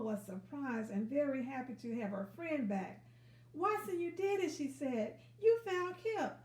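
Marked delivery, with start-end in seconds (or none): 3.5-6.3 high, loud delighted cry; overjoyed, bursting with thanks; high, loud, thrilled; excited. Acted. Lola thanks Watson joyfully.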